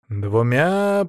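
plain reading voice

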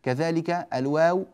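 ordinary delivery

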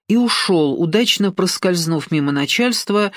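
usual speaking voice

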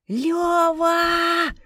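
high, loud panicked yell. A narrator's reading. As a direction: scream for help with drawn-out vowels. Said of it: "вместо ответа заорала Инна".

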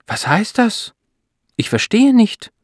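very high-pitched, bewildered questioning; astonished. A narrator's reading. Wife cannot understand the doctor's news, astonished. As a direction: pitch leaping up in disbelief. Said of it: "fragt Beate erstaunt".

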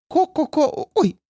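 high startled hen clucking, then oops. A narrator's reading. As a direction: cluck high in fright, then catch yourself. Startled girl clucks like a rooster. (испуганное кудахтанье)

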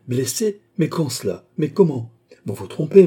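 high, agitated questions building to a loud protest; alarmed questioning. A narrator's reading. Queen questions the police chief about a wound, alarmed. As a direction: fire off startled questions, rising in force.

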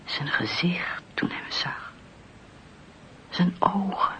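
soft, hushed telling; whispered narration. A narrator's reading. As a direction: let the dread drop to a whisper. Narrator whispers the fearful moment.

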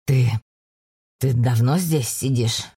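low, slow, barely held back; low male voice holding in anger; through gritted teeth. A narrator's reading. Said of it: "сквозь зубы спросил Торрен". (сквозь зубы)

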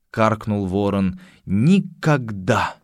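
drawn-out ominous line; slow recited verse. Acted. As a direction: stretch out Nevermore slowly.

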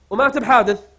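normal talking voice

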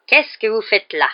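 slow, loud, falling interrogation; suspicious. A narrator's reading. Caretaker confronts a pupil loitering in the corridor, suspicious. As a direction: challenge him word by word. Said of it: "demanda Rusard d'un air soupçonneux".

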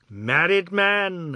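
slow, drawn-out mocking cry of delight. A narrator's reading. Old Ajoda crowing at the new husband, slow and mocking. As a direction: crow it out slowly, teasing. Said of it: "Ajoda cried again".

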